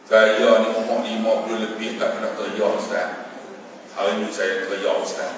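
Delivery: very low, soft and humbled; acted hushed, sunken voice; subdued confession. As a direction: confess quietly after crying. Tearful man's confession to the preacher.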